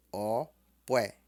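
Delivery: "Opué" split, low O, then middle pué; low-mid Yoruba tones. Shown as a demonstration. Teacher models December for students, low-mid tones. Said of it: "Tom baixo e tom médio".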